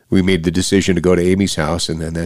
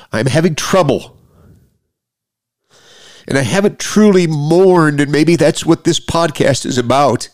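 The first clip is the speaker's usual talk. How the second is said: high, strained with real grief; tearful; 7 semitones higher than usual. Real, not acted. Grieving podcaster confessing to listeners he hasn't mourned, tearful. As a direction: voice climbing as the grief comes out.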